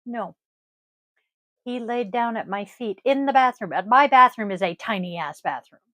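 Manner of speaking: ordinary talk, nothing marked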